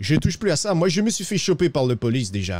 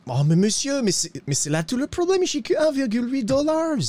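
rising into high-pitched pleading; pleading protest; 10 semitones higher than usual. Acted. Broke rider telling monsieur he cannot pay, rising plea.

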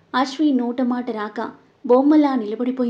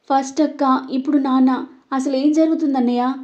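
ordinary delivery

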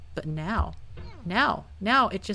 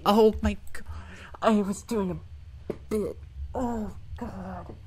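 despairing moan. Real, not acted. Player failing while doing a bit, despairing moan. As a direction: let the words crumble into a weak groan.

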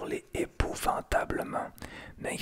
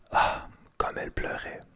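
plain reading voice

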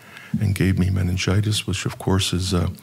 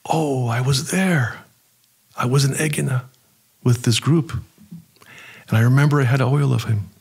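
remembering in sudden awe, pitched up; amazed recognition; 6 semitones higher than usual. Retold or imitated. Recognizing the saint's icon.

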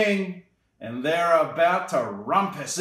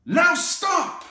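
high, loud command; firm call to stop; 5 dB louder than usual. Acted. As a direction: call a halt to the party. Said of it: "Max said".

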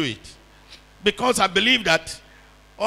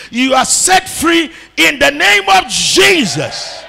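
building from firm to a loud, high shout; preacher roaring the blessing; preaching at full force; 4 semitones higher, 7 dB louder than usual. Real, not acted. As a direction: climb to a full-throated altar call.

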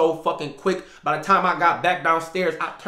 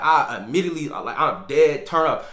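normal talking voice

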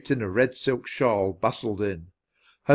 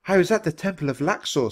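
higher-pitched, mildly pleased; brightening; 8 semitones higher than usual. A narrator's reading. Florence tells guests about her morning, brightening. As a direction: perk up a little at a favourite subject.

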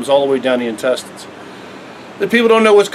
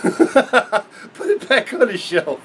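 laughing; 4 semitones higher, 3 dB quieter, much slower than usual. Real, not acted. Laughing at the chemical label.

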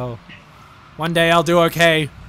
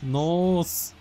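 put-on Australian accent. Teammate says they can't do it, Australian accent demo. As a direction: draw the vowel out like an Australian. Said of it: "Say that with an Australian accent".

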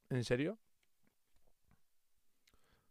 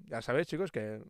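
usual speaking voice

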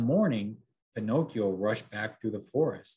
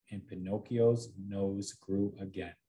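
plain reading voice

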